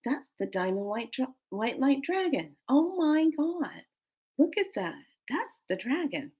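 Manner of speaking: soft, high hushed amazement; retold awe. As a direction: re-enact quiet wonder at the sky. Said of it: "I was like".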